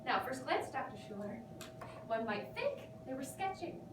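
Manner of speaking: ordinary talk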